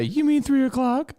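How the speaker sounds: high, mocking imitation; hammed-up nagging mimic; mock Rich voice. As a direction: whine it like a clueless friend. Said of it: "waiting for Rich to say".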